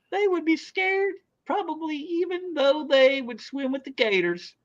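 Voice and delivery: hammed-up Southern belle accent; exaggerated Southern accent, way up high; bad Southern accent. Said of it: "I gotta read that with a Southern accent".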